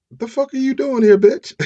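high, loud indignant challenge; mock angry confrontation. Fiancee confronting the woman in her apartment, mock outrage. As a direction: confront an intruder, hammed up with a laugh in it.

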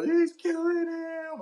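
high, slow, crying imitation of the girlfriend; mock hysterical cry. Imitating the girlfriend wailing over her man, high and teary. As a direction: wail it in a mock panic. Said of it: "this girl".

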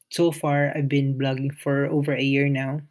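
ordinary delivery

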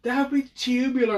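high, loud surfer slang voice; surfer dude. Pretend. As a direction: ham up a California surfer. Surfer bro parody.

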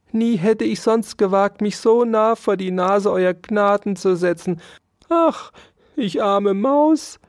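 high, woeful little mouse lament; plaintive mouse. A narrator's reading. Poor mouse pleads with lieber Herr Dauerspeck, lamenting. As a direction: whine pitifully in a high mouse voice. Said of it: "klagte sie und wischte sich ein paar Tränen".

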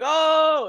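mock high yelp of panic; a high startled shriek, half played; retold scream. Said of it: "I started screaming".

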